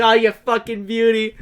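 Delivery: hammed-up impression of a delighted brute; Kano. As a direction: crow it like a movie villain. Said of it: "He's like".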